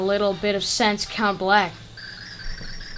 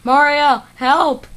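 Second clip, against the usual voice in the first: loud cry for help; Peach calling for help; 6 semitones higher, 8 dB louder, slower than usual. Acted. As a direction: call out to Mario in distress.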